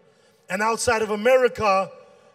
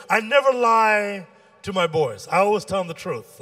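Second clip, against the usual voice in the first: ordinary talk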